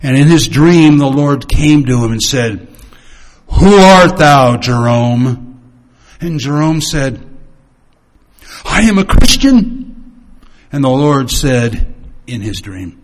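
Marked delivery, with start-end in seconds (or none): 3.5-5.6 slow, solemn, louder voice of God; voice of the Lord. A narrator's reading. The Lord speaks in the dream. 8.6-9.8 higher, louder earnest reply; Jerome answering. A narrator's reading.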